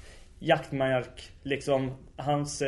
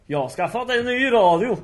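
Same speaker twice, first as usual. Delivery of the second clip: high, loud, proud announcement; imitated delight; 8 semitones higher, 5 dB louder than usual. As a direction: announce it like an overjoyed old man. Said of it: "då kommer han jätteglad". (härmat jätteglad)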